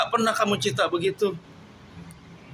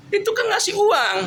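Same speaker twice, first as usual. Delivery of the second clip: angry shout; 13 semitones higher, 4 dB louder than usual. Real, not acted. Arguing about money with wife.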